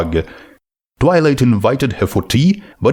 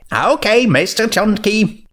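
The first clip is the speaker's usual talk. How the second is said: Twilight voice; 11 semitones higher than usual. Acted.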